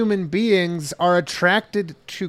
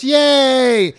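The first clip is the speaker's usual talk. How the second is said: high, loud fake cheer; mock cheer; 7 semitones higher, 6 dB louder than usual. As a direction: cheer like a crowd. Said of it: "everyone goes".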